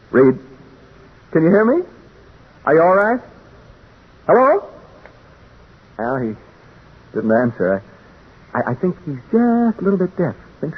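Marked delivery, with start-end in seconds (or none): 0.1-4.7 calling out. Acted. Calling up to the room above.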